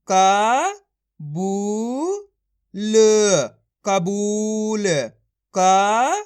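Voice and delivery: loud, drawn-out syllables, then the whole word; syllable drill. Shown as a demonstration. Teacher showing children how syllables join, in loud drawn-out drill. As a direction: sound out each piece like a reading teacher.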